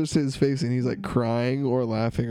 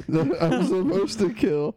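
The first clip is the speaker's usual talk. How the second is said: higher, amused mock realization; laughing through role; 7 semitones higher than usual. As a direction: crack up as you finish the thought. Finishing killer's line while laughing.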